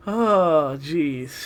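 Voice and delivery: usual speaking voice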